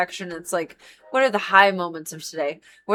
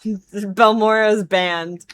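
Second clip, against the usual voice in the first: usual speaking voice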